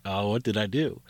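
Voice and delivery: plain reading voice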